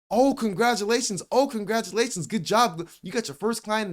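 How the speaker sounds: high, gushing mock family cheer; excitedly imitating congratulations. Family reacts to first client.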